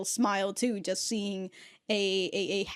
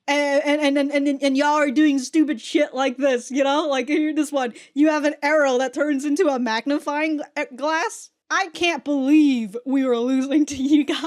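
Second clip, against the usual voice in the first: loud, higher, disbelieving rant; exasperated Catra; 4 semitones higher, 7 dB louder than usual. Retold or imitated. Catra scoffing at the crew, loud exasperated rant. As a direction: rant at how dumb they are, voice pushed up. Catra mocking the crew's incompetence.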